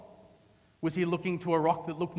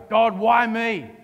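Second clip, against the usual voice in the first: slow, louder cry to God; anguished prayer; 4 semitones higher, 7 dB louder, slower than usual. Retold or imitated. Preacher voicing a struggler crying out to God, slow and loud. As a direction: drag it out like a plea to heaven.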